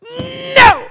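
high, loud shouted no; loud exclaimed no; emphatic no. Retold or imitated. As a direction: shout the refusal. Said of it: "It's just like".

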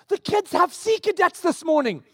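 high, urgent imitation of wife; mock panic. Wife reminding husband of Sea Cadets, in mock panic. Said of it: "in a panic".

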